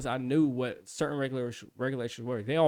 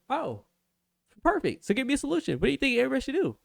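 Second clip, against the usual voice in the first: very high, louder mock-pleased pitch; sarcastic challenge; 11 semitones higher, 3 dB louder, faster than usual. Instructor sarcastically demands a solution in a very high, mock-pleased voice. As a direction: pounce with sarcastic glee.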